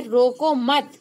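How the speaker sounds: "रोको मत" run together, loud; loud, unbroken "रोको मत", stopping after मत; pause after मत. Shown as a demonstration. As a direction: join मत firmly onto रोको. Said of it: "बाद अगर कॉमा लगा रही हूँ".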